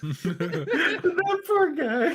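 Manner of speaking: high, drawn-out words through laughter; squeaky, slowed by laughing; real laughing sympathy. Real, not acted. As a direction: laugh helplessly while pitying him.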